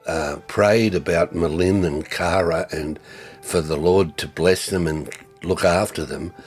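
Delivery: normal talking voice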